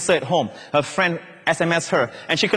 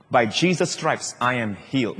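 sense of love; 6 semitones lower, 4 dB quieter than usual. Shown as a demonstration. Preacher showing how to confess, with a sense of love. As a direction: let each phrase settle gently, sinking low. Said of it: "You say it like this".

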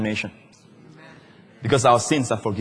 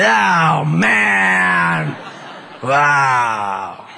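stunned devil; much slower than usual. Pretend.

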